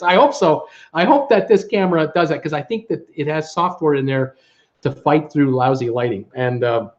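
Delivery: ordinary talk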